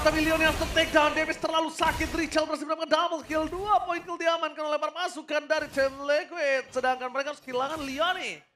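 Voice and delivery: normal talking voice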